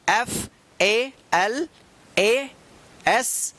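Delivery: letters said very slowly, one by one; spelling it out. Shown as a demonstration. Teacher spelling a word aloud, letter by letter, very slow. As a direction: spell each letter with long gaps. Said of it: "देखिए यहाँ पे".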